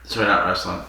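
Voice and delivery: ordinary talk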